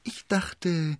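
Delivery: high, wheedling opener; cunning landlord testing the water; sly. A narrator's reading. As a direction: begin craftily in a high voice. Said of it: "schaute ihn listig an".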